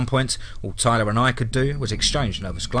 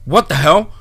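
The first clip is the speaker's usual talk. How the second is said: loud, higher-pitched startled cry; panicked cry; 6 semitones higher, 8 dB louder than usual. A narrator's reading. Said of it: "Brady cried, unusual panic in his voice".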